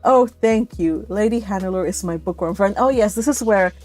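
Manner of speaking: normal talking voice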